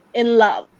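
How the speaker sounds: soft "in", fuller "love"; stress on LOVE. Shown as a demonstration. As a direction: slip past "in" and land on "love". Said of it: "In is softer".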